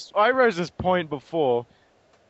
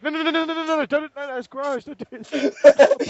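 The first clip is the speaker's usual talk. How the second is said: higher, frantic no no no; panicked pleading; 8 semitones higher, 4 dB louder than usual. Retold or imitated. Optimus begging Ratchet to stop, frantic pleading. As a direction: beg him to stop, slowing at the end. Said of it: "Optimus like".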